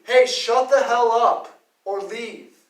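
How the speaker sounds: loud, slow warning to shut up; stern shout. Retold or imitated. Father yelling at rappers on the beach, loud slow shout. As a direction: bark the order like an angry dad. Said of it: "it would be this from me".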